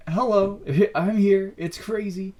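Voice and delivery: high, mostly loud burst of amazement; excited realization. Retold or imitated. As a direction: call out as if suddenly discovering yourself. Acts out the sudden realization.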